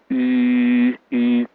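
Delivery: U held long with lips rounded; U begun like English "you", lips rounded. Shown as a demonstration. Teacher demonstrating the vowel to students, rounded U. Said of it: "starting sound of U and plus".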